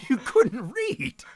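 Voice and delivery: high, slow laughing jab. Real, not acted. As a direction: laugh through the taunt. Laughing at a teammate's plan.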